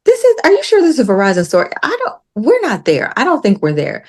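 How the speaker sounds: high-pitched, questioning protest; doubtful challenge. Retold or imitated. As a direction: challenge them, unconvinced, voice lifting high. Retold doubtful challenge to driver.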